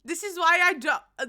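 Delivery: normal talking voice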